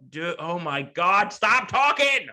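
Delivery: slow build to a high, loud plea; mock-exasperated outburst, rising sharply. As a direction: draw it out, then burst out in mock despair. Acted exasperated reply to coach's rant.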